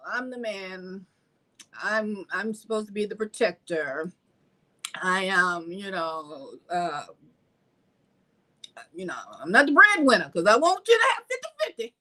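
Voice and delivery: lightly voiced boastful man; mock a self-important man. Voicing a traditional man.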